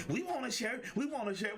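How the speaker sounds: the ladies pestering him to share the quote; mock ladies teasing. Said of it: "all the ladies already messing with me".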